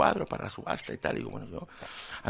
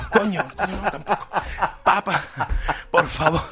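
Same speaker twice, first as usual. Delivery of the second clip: laughing apology; 8 semitones higher, 5 dB louder than usual. Retold or imitated. Swearing, then apologizing through laughter.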